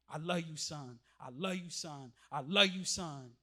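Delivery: hushed and tender, almost breathed; softly repeated fatherly love; tender whisper-soft. Retold or imitated. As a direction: soften right down like a father comforting his son. Testimony retelling pastor's tender words.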